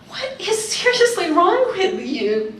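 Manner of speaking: high, loud, slow exasperation; can't believe what she's hearing; mock-exasperated mother snapping at a whining kid; exasperated mom.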